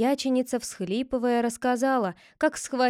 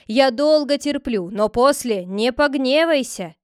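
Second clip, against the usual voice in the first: higher, louder scolding tirade; angry scolding; 4 semitones higher, 6 dB louder than usual. Acted. The sister-in-law scolds the head for his schemes, angry. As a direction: berate him, threatening. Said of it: "вскричала она".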